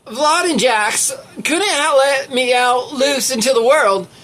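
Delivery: Leslie. Acted. Reader pretending to be someone whose last name escapes them, put-on voice. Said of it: "what my voice sounds like when I pretend to be, um, uh, Leslie".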